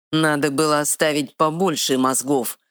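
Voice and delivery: ordinary delivery, nothing marked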